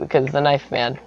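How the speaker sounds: usual speaking voice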